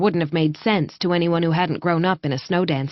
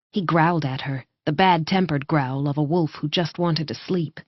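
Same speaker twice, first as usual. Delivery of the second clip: plain reading voice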